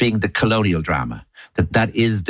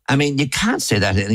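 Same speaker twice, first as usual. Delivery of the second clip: normal talking voice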